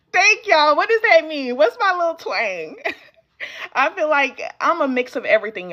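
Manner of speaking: amused, laughing off a compliment. Real, not acted. Laughing as she answers a viewer's comment about her twang.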